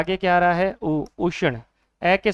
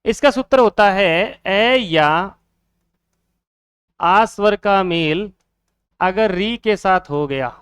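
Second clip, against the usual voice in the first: slow dictation; slower than usual. Shown as a demonstration. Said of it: "teacher dictating the rule".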